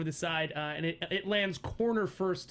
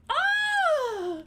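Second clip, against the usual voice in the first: loud, very high terrified shriek; mock-terrified high scream; high-pitched scream; 6 dB louder than usual. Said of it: "screaming at a high pitch".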